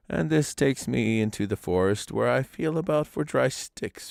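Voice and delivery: lightly voiced old grandfather, unhurried; old man's voice. A narrator's reading. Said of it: "old man explaining ropes".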